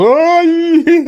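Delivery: loud high squeal; silly demonstrated noise. Shown as a demonstration. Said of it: "Used to do this".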